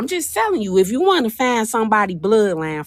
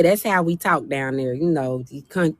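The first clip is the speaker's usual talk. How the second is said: usual speaking voice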